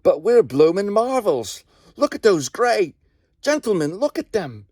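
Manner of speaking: can't believe your eyes, point and yell; awestruck excitement. Acted. Soldier awed by falling enemy.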